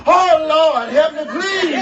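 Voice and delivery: high, loud comic cry for mercy; hammed-up agonized shout; hollered plea. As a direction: holler to the Lord as if burning. Acting out burning pepper agony.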